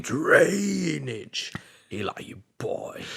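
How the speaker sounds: long high cry dropping into a slow, hushed sneer; Daniel's breakdown. Acted. Acting out Daniel's breakdown at Eli, unhinged. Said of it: "breakdown and end up batting in".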